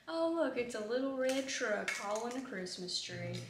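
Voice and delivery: mock Southern accent, hammed up a little. Retelling a funny reel to viewers in a mock Southern accent. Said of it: "he's talking in a Southern accent".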